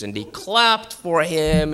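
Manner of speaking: ordinary talk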